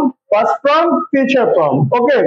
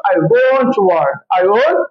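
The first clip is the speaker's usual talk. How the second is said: slow model sentence; 3 semitones lower, much slower than usual. Shown as a demonstration. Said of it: "তখন কি হবে?".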